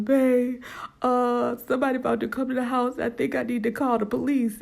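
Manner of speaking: mock-frantic plea to husband; a touch of panicked tears; retold crying. Wife telling husband, mildly tearful and frantic. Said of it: "I go to him crying".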